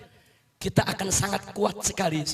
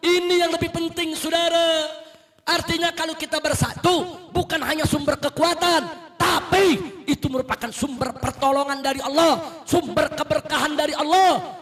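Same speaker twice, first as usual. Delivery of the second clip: loud, high preaching building to a shout; fiery sermon; 4 semitones higher, 5 dB louder than usual. Real, not acted. Preacher rousing the congregation, loud and rising. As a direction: preach with full fire to the crowd.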